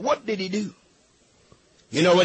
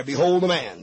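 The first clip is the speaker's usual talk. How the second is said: Behold the man dropped lower, savored; lower repeat; 7 semitones lower than usual. Shown as a demonstration. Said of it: "I could preach a week right there".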